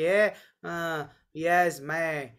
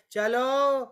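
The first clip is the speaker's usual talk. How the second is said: higher, bright rallying call; splashing water on elephants, cheerful higher call; 8 semitones higher than usual. Retold or imitated. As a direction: urge the elephants to sing. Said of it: "डाल के बोलने लगे".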